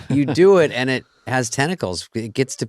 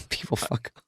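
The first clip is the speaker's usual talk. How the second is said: softer, breathy echo under a laugh; whispered aside; 2 semitones higher, 9 dB quieter than usual. Real, not acted. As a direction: murmur it to yourself, amused. Laughing aside echoing the point.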